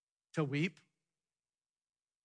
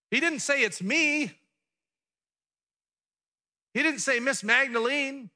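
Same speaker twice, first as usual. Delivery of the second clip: raised high and loud, preaching hard; emphatic; 8 semitones higher, 6 dB louder than usual. Real, not acted. Preacher to congregation, loud emphatic contrast. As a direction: drive home what he didn't say.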